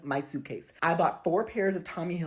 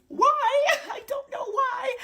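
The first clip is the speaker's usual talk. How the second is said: much higher, louder laughing disbelief; baffled that customs would steal undies; incredulous why; 3 dB louder, slower than usual. Real, not acted. Reacting to customs comment.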